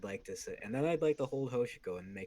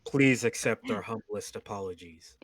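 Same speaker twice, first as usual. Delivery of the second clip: repeated apology; 8 dB louder than usual. Acted. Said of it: "I say again".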